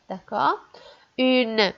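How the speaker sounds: normal talking voice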